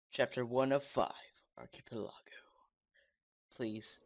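usual speaking voice

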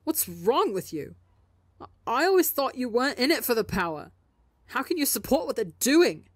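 hurt, rising disbelief; stung and accusing; betrayed. Acted. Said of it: "absolutely betrayed".

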